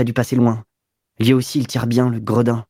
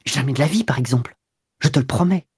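ordinary delivery

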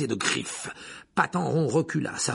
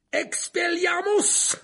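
much higher, louder, slow drawn-out cry; shouted spell; 4 dB louder, much slower than usual. Acted. Harry disarms Petit Gros, shouting the spell. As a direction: cast it out with all your force. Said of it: "cria Harry".

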